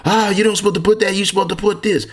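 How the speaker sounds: high, fast mock voice of a know-it-all; mocking objector. Instructor mimicking people who argue with him, high and fast. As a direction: mimic someone arguing the wrong way. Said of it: "a person will argue me that".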